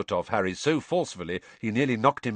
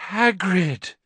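relieved croak; 10 semitones higher, 4 dB louder than usual. A narrator's reading. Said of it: "Harry croaked in relief".

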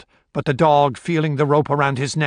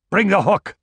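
raised-pitch command; 6 semitones higher, 4 dB louder than usual. A narrator's reading. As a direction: give it as an urgent order. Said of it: "commanded Balfour".

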